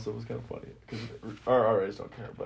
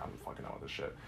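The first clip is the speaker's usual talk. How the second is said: whispered, much softer, fed up; muttered under breath; 9 semitones lower, 16 dB quieter, faster than usual. Retold or imitated. Fed-up roommate swearing to himself about the suite mate, whispered. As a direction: breathe it out, done with him.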